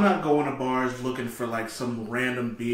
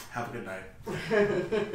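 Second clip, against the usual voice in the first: laughing goodbye; 5 semitones higher, 5 dB quieter, much slower than usual. Retold or imitated. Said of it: "was like".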